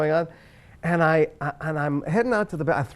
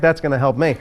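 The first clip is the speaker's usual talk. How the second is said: ordinary talk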